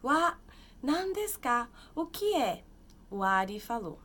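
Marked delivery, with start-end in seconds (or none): 0.0-2.6 high, loud mock surprise; surprised Ri. Teacher voicing Ri again, mock surprised. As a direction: gasp at the present playfully.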